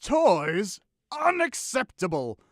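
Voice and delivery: villain explodes in outrage; outraged shout. Acted.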